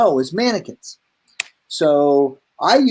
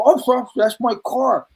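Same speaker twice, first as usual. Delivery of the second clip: higher, flustered mock pleading; mock panic; 6 semitones higher, faster than usual. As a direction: imitate yourself anxiously answering the police. Retold panic, answering the policeman.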